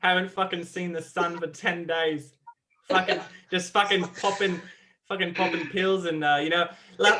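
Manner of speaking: lightly put-on Australian accent, laughing in places; shitty Australian accent. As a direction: play a rough Aussie bloke in Australian accent. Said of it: "Do your Australian accent".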